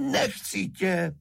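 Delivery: high, drawn-out cry; stubborn high yell; shouted rejection. A narrator's reading. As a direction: cry out the refusal, stretching it. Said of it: "křikl šejtroček".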